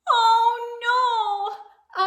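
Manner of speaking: high loud dismayed cry. Acted. Reader reacting to popped button, high dismayed cry. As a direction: gasp it out, shocked.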